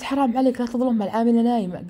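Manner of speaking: indignant defence. Retold or imitated. Aunt defends maid in retold quarrel. (عتاب مستنكر بصوت أعلى)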